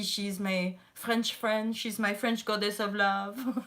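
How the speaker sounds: lightly put-on French accent, slow; a playful French accent for her friend. Describing her French friend Gigi, in a light French accent. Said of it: "I always have to do this accent".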